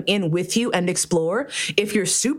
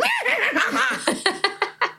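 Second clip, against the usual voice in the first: high mock horse neigh; a high, fluttering whinny for comic effect; pony whinny; 12 semitones higher than usual. Mocking adults at pony play, high whinny. Mimicking adults playing ponies.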